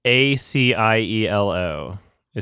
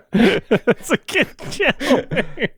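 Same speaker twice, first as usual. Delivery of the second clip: high, slow, laughing through the words; cracking up while saying it; much slower than usual. Real, not acted. Laughs at channel name.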